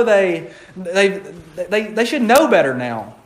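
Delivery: normal talking voice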